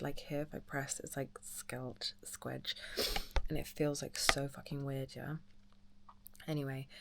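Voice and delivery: soft hushed whisper. Real, not acted. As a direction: whisper so nobody wakes. Whispering late at night.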